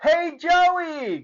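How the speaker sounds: high, loud shout across the crowd; yelling. A narrator's reading. Clark yells for Joey across the crowd. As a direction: yell to find your buddy. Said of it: "Clark yelled".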